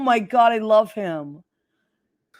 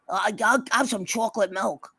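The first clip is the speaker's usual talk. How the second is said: put-on a light Long Island accent, little-boy voice. Aunt mimicking her nephew's childhood Long Island accent, lightly. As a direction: slip into a mild Long Island accent as a kid asking for milk. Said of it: "kind of Staten Island, Long Island accent".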